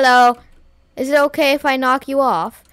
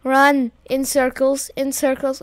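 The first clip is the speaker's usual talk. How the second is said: ordinary talk, nothing marked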